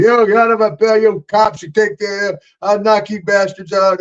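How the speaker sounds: high-pitched retold yelling; nephew yelling. Nephew hollering at the cops from lockup, retold high yell. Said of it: "I can hear my nephew in there going".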